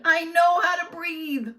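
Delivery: very high, loud angry shout. A narrator's reading. Teacher yelling she knows how to breathe. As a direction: yell it back in fury.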